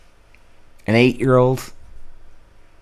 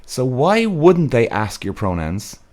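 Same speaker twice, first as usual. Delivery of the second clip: normal talking voice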